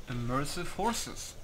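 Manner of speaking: ordinary delivery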